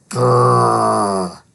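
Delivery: low growl; dog growl. Acted. Dog Harley growls at a sound. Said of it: "Harley growled".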